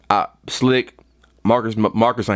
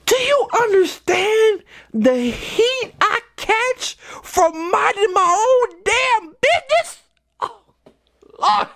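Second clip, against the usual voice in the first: exasperated; slower than usual. Real, not acted. Venting about catching heat.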